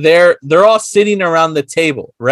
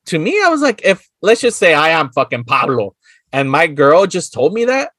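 normal talking voice

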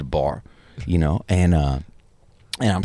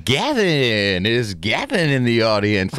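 raised, drawn-out stage announcer call; mock announcer, pitched up on the name; 3 semitones higher, slower than usual. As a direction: imitate an emcee calling into the crowd. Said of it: "the guy goes ... like this".

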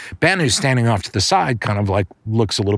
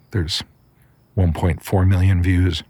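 plain reading voice

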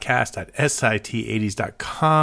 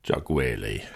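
low, drawn-out name echo; deep radio voice; 12 semitones lower, 7 dB quieter, slower than usual. Pretend. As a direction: boom it like a DJ. Co-host echoes name deep.

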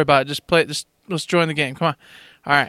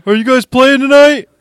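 a put-on higher voice, louder, playing dumb; higher, louder Howard voice; 8 semitones higher, 9 dB louder than usual. Mocking the late friend in a put-on voice. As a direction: lift the voice and play clueless.

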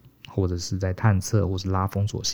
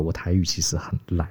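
unvoiced whispered confession; 2 semitones lower, 4 dB quieter than usual. Real, not acted. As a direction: breathe it out like a sheepish secret. Confessing poor Taiwanese, aside. (耳语般小声坦白)

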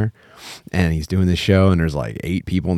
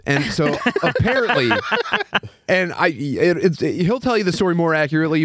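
usual speaking voice

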